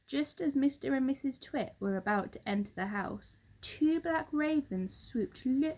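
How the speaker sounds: usual speaking voice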